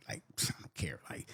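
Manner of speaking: breathy shrugging whisper, not bothered; whispered aside. Real, not acted. Man whispering he doesn't care about cheaters, hushed. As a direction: drop to a whisper.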